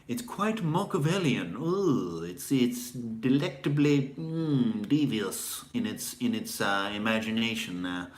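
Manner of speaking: savoring, drawn-out British villain drawl; put-on haughty British accent, lingering on words; haughty British tyrant. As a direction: relish it like a scheming British tyrant. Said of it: "the haughty British tyrant".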